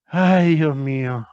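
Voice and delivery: a loud, high "Ay" that sinks into "Dios mío"; exasperated sigh. Real, not acted. Teacher to student, exasperated by the mayúsculas rule. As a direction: throw up your hands at the nonsense.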